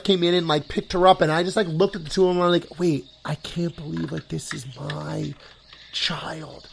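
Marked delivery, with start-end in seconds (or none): 2.8-6.7 much softer, inward voice; hushed emotional awe. Retold or imitated. Dad looking at his wife and child, hushed emotional awe. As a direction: trail down into quiet disbelief. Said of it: "get, like, emotional in my own head".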